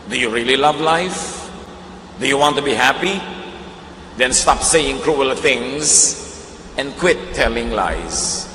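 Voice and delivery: slow, measured Bible reading; preacher reading a Bible verse slowly to the congregation; scripture reading. Acted. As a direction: read the verse with weight. Reads scripture quotation.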